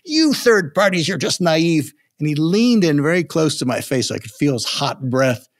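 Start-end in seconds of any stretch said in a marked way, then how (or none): 0.0-1.9 much higher, imitating a furious president; retold angry outburst. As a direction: replay the president's rant with mock fury. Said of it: "he just flew off the handle".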